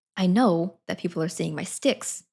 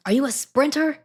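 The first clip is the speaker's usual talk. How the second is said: retold yell; 7 semitones higher, 5 dB louder than usual. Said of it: "he yelled".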